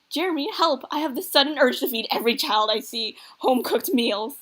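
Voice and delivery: loud, melodramatic cry for help; mock panic. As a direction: gush loudly in pretend distress. Dad playfully panics about grandparenting.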